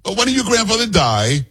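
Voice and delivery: a pushy Italian woman, played up; bold Italian woman. Nosy Italian woman pressing the anchor, hammed-up voice. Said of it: "this is how bold Italian women are".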